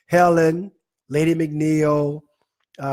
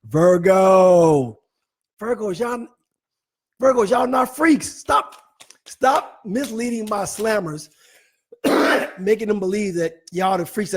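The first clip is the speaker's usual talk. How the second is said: much higher, louder teasing rebuke; mock scolding; 6 semitones higher, 7 dB louder, slower than usual. Real, not acted. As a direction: wag a finger at the Virgos. Host scolds Virgos loudly.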